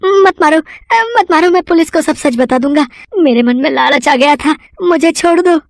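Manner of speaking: squealing plea for mercy; terrified begging. Acted. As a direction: beg for the beating to stop. Begging the invisible Hanuman.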